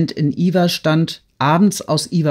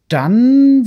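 much higher, loud startled echo; sincere astonishment; 8 semitones higher, 8 dB louder than usual. A narrator's reading. Anatol echoes Dolochow's question in honest astonishment. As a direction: caught off guard by the thought. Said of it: "mit aufrichtigem Erstaunen".

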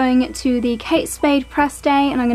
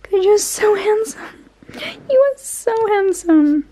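cooing at pets; 10 semitones higher than usual. Real, not acted. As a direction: coo it high, adoring your dogs. Cooing praise at the dogs.

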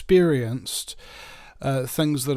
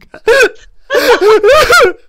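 loud, high comic sobbing; hammed-up bawling of a hurt little cell; mock crying; 15 dB louder than usual. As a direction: wail in high, broken sobs. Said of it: "sees this little cell crying".

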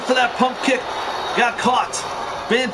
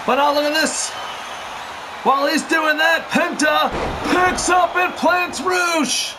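excited live play-by-play, raised voice; wrestling commentator; 4 semitones higher than usual. Real, not acted. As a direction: call the action as it happens.

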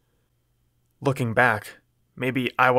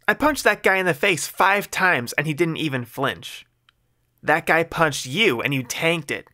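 snapping woman; 5 semitones higher than usual. Acted. Mandy snapping at the man who helps her, higher irritated voice. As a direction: snap back at him, much higher. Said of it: "she snapped".

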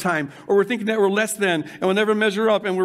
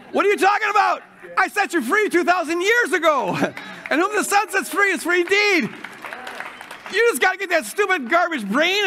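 joyful divine bellow; booming Creator shout; 9 semitones higher than usual. Retold or imitated. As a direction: shout it like God can't believe you doubt. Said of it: "the Creator says".

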